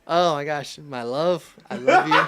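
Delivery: loud, high, delighted gush; excited gushing. Real, not acted. Husband reacting to his wife Amy, loud laughing adoration. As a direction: burst out adoringly with a laugh in it. Gushes on learning Amy is there.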